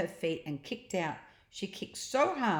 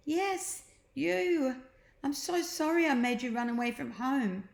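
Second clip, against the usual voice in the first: higher, relieved sister; Jemima, relieved; 8 semitones higher than usual. A narrator's reading. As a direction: glad to find him. Jemima apologising.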